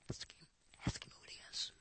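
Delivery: hushed mock whisper to a friend. Peter secretly whispering to John, ask him who. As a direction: whisper it on the sly. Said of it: "he probably went".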